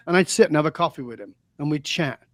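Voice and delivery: usual speaking voice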